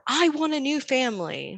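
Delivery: high-pitched mock tantrum; whine-yell like an upset kid, not full volume; retold child's scream. Mom quoting her angry son, restrained mock scream. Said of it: "at one point he screamed".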